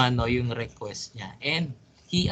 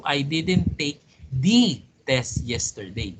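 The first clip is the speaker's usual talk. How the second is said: "the" said as a long, punched "thee"; single out "the" as "thee" with heavy stress. Shown as a demonstration. Teacher hinting at a different test, stress on "the". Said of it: "taking up another word".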